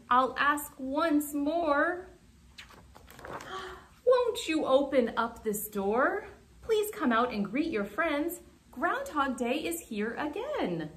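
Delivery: put-on imploring, read for children; pleading. A narrator's reading. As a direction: coax and plead as the mayor. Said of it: "The mayor pleaded".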